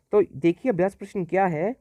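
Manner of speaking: ordinary delivery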